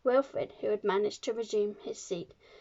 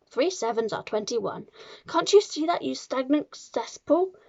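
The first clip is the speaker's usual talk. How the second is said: raised, emphatic scolding that surges on insults; shouting tirade; 6 semitones higher, 5 dB louder than usual. A narrator's reading. Trunchbull rants at Wilfred over three times table, shouting. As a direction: berate a hopeless pupil. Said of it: "shouted the Trunchbull".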